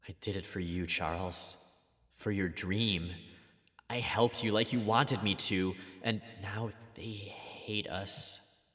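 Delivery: soft, sorrowful murmur; quiet grieving voice. Acted. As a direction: say it with a heavy heart. Voicing Jean grieving.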